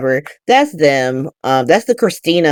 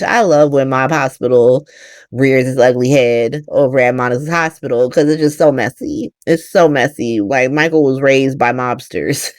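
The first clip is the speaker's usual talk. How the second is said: ordinary delivery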